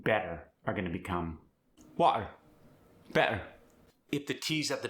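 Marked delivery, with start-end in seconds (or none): 2.0-2.3 South African "water", loud with a glottal stop; South African accent. Shown as a demonstration. Teaching the middle T, South African "water". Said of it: "you get that glottal stop". 3.1-3.4 South African "better" with a glottal stop; South African accent. Shown as a demonstration. Teaching the middle T, South African "better". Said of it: "you get that glottal stop".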